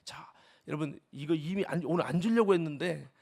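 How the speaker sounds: usual speaking voice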